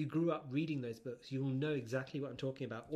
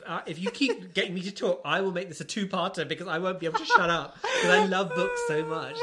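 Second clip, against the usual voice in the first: excited; 4 semitones higher, 9 dB louder than usual. Real, not acted. Excited rant about loving books.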